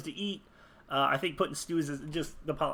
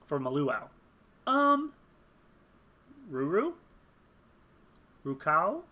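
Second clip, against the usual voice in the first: normal talking voice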